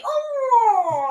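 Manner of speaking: high swooning oh; delighted oh. Real, not acted. Guest picking birthday Chinese food, swooning oh. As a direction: sigh it out like you can taste it. Craving favourite Chinese dishes.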